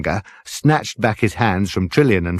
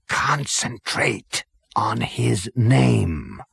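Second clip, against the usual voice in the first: slow séance voice; 4 semitones higher, much slower than usual. Acted. Zaphod leading the séance, slow and hushed. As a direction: draw it out like a medium.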